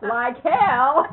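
loud laughing scoff; laughing retort. Real, not acted. The teller laughing off a teasing remark.

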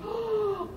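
a quick, soft, high gasp of shock; sharp high gasp. Acted. Gator sees his scaly skin, a soft shocked gasp.

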